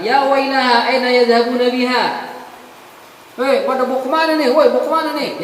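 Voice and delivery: high, loud cry of alarm; a dead sinner crying where are they taking me; mock alarmed shouting; panicked outcry. Wicked corpse cries out.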